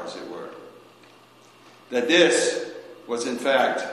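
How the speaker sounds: ordinary talk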